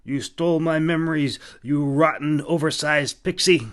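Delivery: man accusing the fairy of theft, furious; angry accusation. A narrator's reading.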